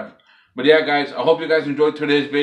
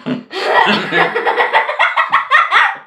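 high airy wheezing laugh; helpless wheezing giggle; breathless high laugh; 8 dB louder than usual. Real, not acted. Candy fell out.

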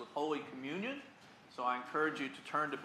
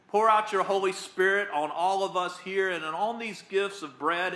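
higher, louder invocation; fervent prayer; 4 semitones higher, 8 dB louder than usual. Real, not acted. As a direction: call down the Spirit fervently. Loud epiclesis prayer.

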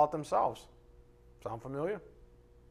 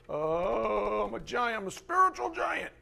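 boastful voice pitched higher, lightly hammed; mock boaster; 6 semitones higher, slower than usual. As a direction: puff yourself up as a spiritual giant, mocking. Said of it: "like to brag and boast".

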